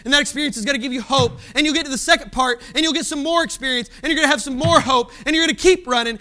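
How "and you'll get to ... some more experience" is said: ordinary talk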